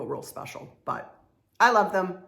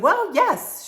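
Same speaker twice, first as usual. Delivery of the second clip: mock booth owner; 11 semitones higher, 7 dB louder than usual. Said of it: "And she said".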